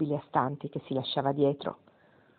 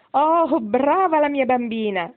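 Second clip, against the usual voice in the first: much higher and louder, slow and glowing praise; hearty old woman's cry of delight; delighted exclamation; 8 semitones higher, 6 dB louder, slower than usual. A narrator's reading. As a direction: grandmother exclaiming with joy at the child. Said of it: "esclamò Giuliva".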